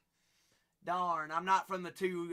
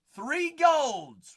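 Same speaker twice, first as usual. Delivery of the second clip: loud, high announcement; excited call-out; 9 semitones higher, 10 dB louder than usual. Real, not acted. Host announcing three golds on stream, calling out. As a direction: belt out the pull.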